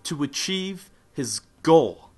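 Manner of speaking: hammed-up rousing speech; rousing declaration. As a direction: hit it like the climax of a pep talk. Motivational build-up, punchy.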